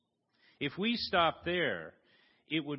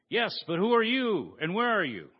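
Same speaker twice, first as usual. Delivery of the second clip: louder, higher, urgent question; anxious call; 5 semitones higher, 6 dB louder than usual. Acted. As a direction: call up, desperate to know. Jack anxious, calling up.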